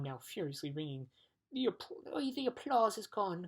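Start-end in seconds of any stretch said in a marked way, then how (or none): 1.5-3.5 high, louder disappointed whine; complaining. A narrator's reading. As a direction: whine it like a let-down child. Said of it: "complained the disappointed humbug".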